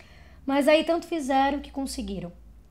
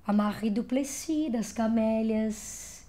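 ordinary talk

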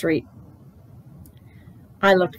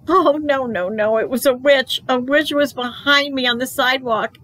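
startled panic; 6 semitones higher than usual. A narrator's reading. Junie B spots witch.

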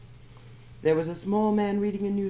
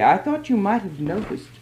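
a quick, nervous reply; quickly; 4 semitones higher, 6 dB louder than usual. A narrator's reading. Woman answers the shopkeeper quickly, a touch nervous. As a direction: hurry the words out to cover herself. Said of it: "She said quickly".

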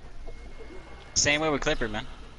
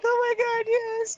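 much higher, mock tearful wail; mock crying; slower than usual. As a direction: imitate a whiny near-sob, pitched way up. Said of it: "he acted like he was about to cry".

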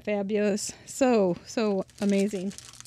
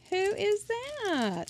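playful high voice; 11 semitones higher, much slower than usual. Pretend. Playful reveal of the robot card.

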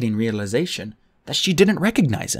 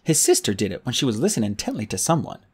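ordinary delivery, nothing marked

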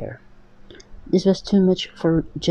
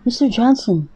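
raised call across the room; 6 semitones higher than usual. A narrator's reading. Daughter calling out to her father, raised voice.

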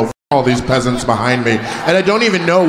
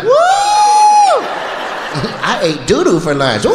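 wife's whooping laugh; much slower than usual. Retold or imitated. Comic imitating the wife cheering at anything. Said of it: "And she'd be like".

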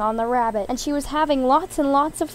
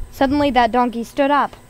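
ordinary delivery, nothing marked

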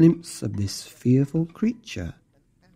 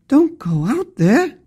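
high, drawn-out panicky warning; hammed-up alarm, stretched out high; gasped warning; 13 semitones higher, 4 dB louder, slower than usual. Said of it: "gasped her family".

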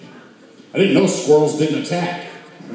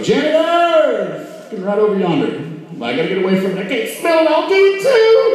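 calling out; 10 semitones higher than usual. Retold or imitated. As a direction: holler for the janitor in a squeamish panic. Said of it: "That's why I always go".

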